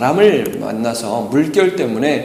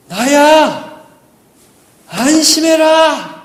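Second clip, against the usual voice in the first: much higher, loud shout across the water, slow on the last word; calling out far; 11 semitones higher, 8 dB louder, much slower than usual. Retold or imitated. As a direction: yell out to the disciples far off on the sea. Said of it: "아마 이렇게 소리 지르셨던 것 같아요".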